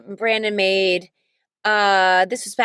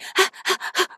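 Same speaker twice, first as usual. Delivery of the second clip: quick panting breaths, acted; running gasps; 10 semitones higher, 3 dB quieter than usual. Shown as a demonstration. Actor showing how she voiced a kid running, acted gasps. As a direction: gasp as if running and being tugged. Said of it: "sound like I was being tugged around".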